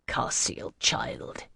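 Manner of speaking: softly breathed, near-whispered address; gentle priest, barely voiced. A narrator's reading. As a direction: say it tenderly, very softly. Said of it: "he said gently".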